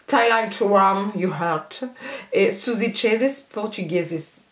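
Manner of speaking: slow, put-on English accent on the polish name; hammed-up English accent for the polish names; mock English accent. Reading polish names to viewers in a mock English accent. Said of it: "mon accent, il est trop bien".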